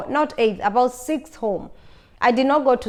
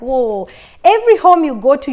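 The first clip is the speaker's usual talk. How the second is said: louder exasperated whoa; a worn-out house hunter venting, louder and exasperated; 3 semitones higher, 7 dB louder than usual. Retold or imitated. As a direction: blurt it out, fed up with house hunting. Buyer's frustration at every house.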